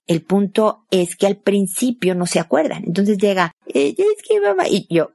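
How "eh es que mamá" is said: put-on high, whining little kid; whiny child voice. Small child whining to mom, high mock whiny voice. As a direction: whimper it like a child begging mom. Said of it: "con voz llorona".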